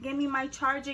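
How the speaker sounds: usual speaking voice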